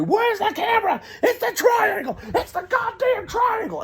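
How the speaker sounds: very high, hammed-up desperation; frantic wailing. Passenger throwing laundry to find a camera, high frantic wailing. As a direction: wail it high, drawing out the end.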